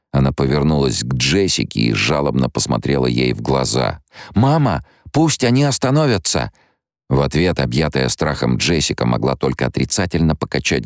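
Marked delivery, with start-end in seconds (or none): 4.3-6.5 put-on much higher, a child begging; pleading girl. Alia begging Jessica to make them stop, childlike. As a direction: cry out to mama for help.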